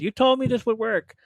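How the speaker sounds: loud, high-pitched indignant complaint, played up; mock accusation. Sunburnt speaker accusing the cousins, mock indignant. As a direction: protest loudly as if betrayed, hammed-up.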